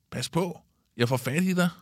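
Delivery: high-pitched mild threat; called-out warning. A narrator's reading. Pip chasing teasing Kalle, high called-out warning. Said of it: "råber Pip".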